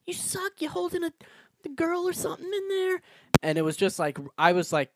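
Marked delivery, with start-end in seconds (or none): 0.1-3.0 very high squeaky jeering crowd voice; mock angry mob. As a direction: jeer like a cartoon mob.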